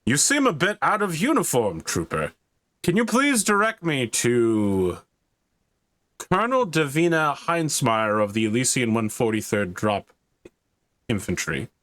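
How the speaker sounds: courteous officer addressing a trooper, starting high and falling; high-pitched openings that sink at each phrase end; Space Marine. Acted. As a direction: play a formal warrior asking for directions. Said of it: "holds a hand out to you and says".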